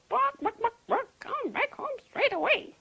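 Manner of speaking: high, clucking hen ordering chick home; loud-pitched clucks into a call; mother hen calling. A narrator's reading. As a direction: squawk like a bossy mother hen. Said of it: "And then Mum called".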